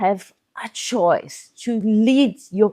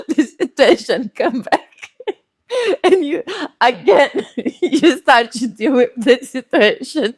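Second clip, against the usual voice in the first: talking through laughter, higher; laughing; 4 semitones higher, 4 dB louder than usual. Real, not acted. Speaker amused by own toilet story, laughing. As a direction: let the laughs break through the words.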